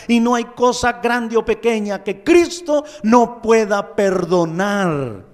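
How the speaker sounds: fiery revival preaching; preaching at full force. Acted. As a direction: build the fervour line on line.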